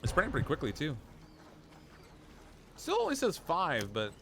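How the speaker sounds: ordinary talk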